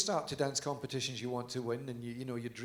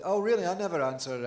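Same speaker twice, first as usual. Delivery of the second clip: a sarcastic, higher, louder "oh really" brushing off a prank; mock sarcastic retort; 5 semitones higher, 8 dB louder than usual. To a caller he believes is Ron pranking him, loud sarcastic scoff. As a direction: scoff at the caller as if it's a friend's joke.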